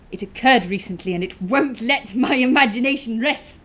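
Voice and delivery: worked up, unable to let it rest; agitated. Acted. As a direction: build to a loud, troubled outburst. Narrator troubled by the whistling.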